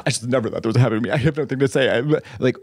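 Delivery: fast, breathless fake modesty; mock humble winner. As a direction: gush fake modesty at the podium.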